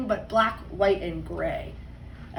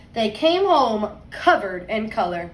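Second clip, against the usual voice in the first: put-on lively read-aloud voice for kids; story narration; 2 semitones higher, 6 dB louder, slower than usual. A narrator's reading. Teacher reading a picture book to her class, storytime voice.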